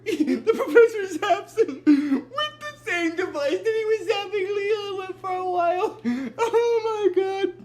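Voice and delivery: high, laugh-choked retelling; laughing helplessly. Real, not acted. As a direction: crack up while telling it. Retelling a part that amuses them.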